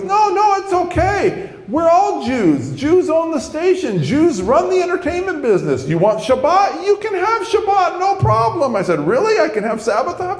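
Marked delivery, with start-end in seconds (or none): 0.0-8.7 very high, exuberant reassuring voice; hammed-up squeaky, emphatic enthusiasm; Rich. TV man reassuring job prospect, very high exuberant voice. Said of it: "this one guy named Rich". 9.0-10.4 amazed. Retold or imitated. Convert hearing he gets Sabbath off, amazed. Worker amazed by job offer.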